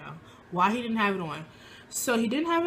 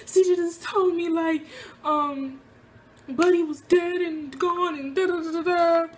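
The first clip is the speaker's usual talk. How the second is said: higher, louder, rushed frantic blurt to mom; frantic retelling; 8 semitones higher, 3 dB louder than usual. Retold or imitated. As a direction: blurt it all out to mom in a panic. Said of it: "I was like".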